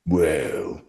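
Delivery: a low, soft dim ogre drawl; lightly voiced dim ogre. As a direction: play the slow-witted ogre. Ogre character line.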